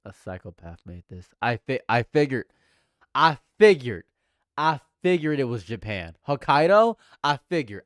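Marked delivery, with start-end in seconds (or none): 1.4-7.8 triumphant. Real, not acted. Streamer guesses flavor's origin, triumphant.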